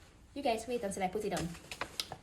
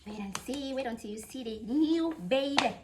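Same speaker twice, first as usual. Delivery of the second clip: usual speaking voice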